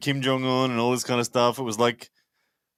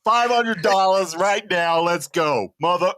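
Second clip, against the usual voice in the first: hammed-up challenge, imitating the rival arm wrestler; rival's trash talk. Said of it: "How about you impersonate me for a sentence".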